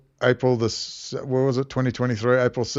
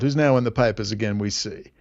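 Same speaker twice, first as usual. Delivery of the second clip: ordinary delivery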